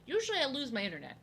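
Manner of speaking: normal talking voice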